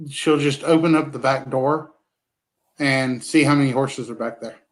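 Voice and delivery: normal talking voice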